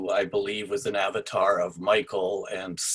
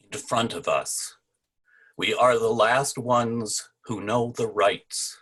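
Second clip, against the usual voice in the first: raised, declaiming voice for a play excerpt; dramatic reading; 4 semitones higher, 4 dB louder than usual. Acted. Puppeteer reading his friend's play excerpt aloud, declamatory. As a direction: proclaim it like a stage line to the room.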